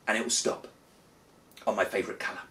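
ordinary talk, nothing marked

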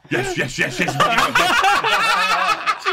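very high, squealing laughing yeses; excited squeal. Retold or imitated. Ian Wright squealing yes at the bar. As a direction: shriek it out with glee, stretched long.

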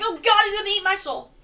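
high, loud mock panic; hammed-up terrified shriek; panicked yell. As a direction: scream like it's coming for you. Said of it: "I'm like".

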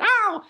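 very high sharp squeal; a bitten owner's sudden high squeal; squeak. Retold or imitated. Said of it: "I bite them".

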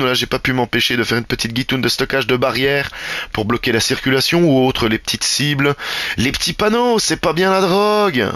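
normal talking voice

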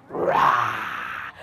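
low, rasping "rah"; a rough storybook lion growl-roar. Acted. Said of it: "when I say lion, can you go".

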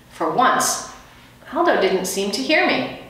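plain reading voice

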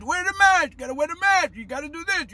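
high, insistent mimicked orders; mock media nagging. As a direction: imitate the media hammering out a command. Mimics media nagging about masks.

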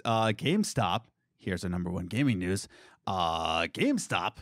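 deep, low, put-on announcer voice; exaggerated cartoon intonation. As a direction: drop into a silly low voice. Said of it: "Why are you talking like that?".

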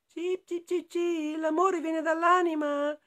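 very high, piping little voice; chirping birds. A narrator's reading. Little birds answering the friends, high chirping voice. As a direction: tweet the words out high. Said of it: "rispondono gli uccelli".

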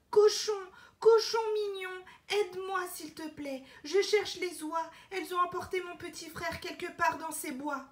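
put-on little girl calling and begging; calling out to the pig. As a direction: call the pig urgently. Elena calls the pig.

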